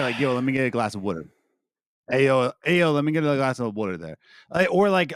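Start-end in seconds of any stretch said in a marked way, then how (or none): 0.2-1.3 quick, casual Philly accent demo. Shown as a demonstration. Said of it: "that's how you say it". 2.1-4.2 fast street-corner Philly accent. Shown as a demonstration. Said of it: "that's how you say it".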